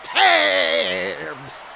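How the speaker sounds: booming TV wrestling host welcome; wrestling announcer. Acted. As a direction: hype the show open like a ring announcer. Opens a mock wrestling broadcast.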